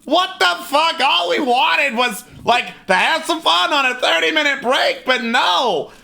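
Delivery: high, indignant goblin tirade with laughter breaking through; angry goblin rant. Acted. Goblin scolding the party over the checkers game. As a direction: rant like an offended little goblin. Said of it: "The goblin turns to you and is like".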